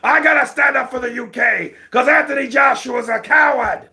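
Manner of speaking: high, loud shouting callout; Tyson Fury. Retold or imitated. Fury calling out Deontay and Joshua, shouting high. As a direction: bellow the challenge like Fury on the mic. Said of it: "Tyson Fury comes out of nowhere".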